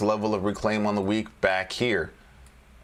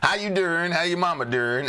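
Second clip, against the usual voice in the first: playful greeting; 8 semitones higher than usual. Acted.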